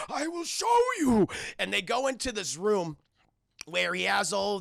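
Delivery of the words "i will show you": high, loud hammy villain; the Doctor. Retold or imitated. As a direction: proclaim it with mad grandeur. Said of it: "He's like".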